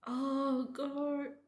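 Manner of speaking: high, loud, overwhelmed cry of "oh God"; real tearful. Real, not acted. Reader overcome by the story, tearful. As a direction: exclaim it through real tears. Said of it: "I'm crying like crazy right now".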